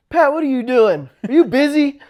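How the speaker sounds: loud high friendly call; retold call; calling over. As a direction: shout across to a neighbour. Officer calls over to him.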